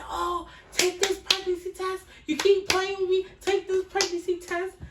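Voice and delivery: high, loud yelling mom voice; a mother shouting at her daughter to take the test. Retold or imitated. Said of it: "My mother's screaming".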